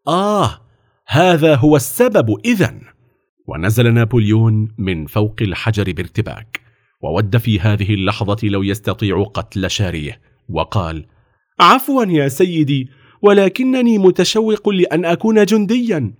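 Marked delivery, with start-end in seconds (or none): 0.1-2.8 higher-pitched dawning realisation; officer's exclamation. A narrator's reading. Officer finding the stone, exclaiming. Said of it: "وهتف الضابط". 11.6-16.1 put-on much higher young voice; eager pleading boy. A narrator's reading.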